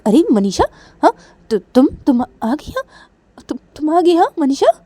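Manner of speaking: loud, high, stammering astonishment; startled surprise. Acted. Mother suddenly sees Manisha has come, loud surprised greeting. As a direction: exclaim in surprise, voice lifting into questions. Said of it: "अचानक से मनीषा को देखती है".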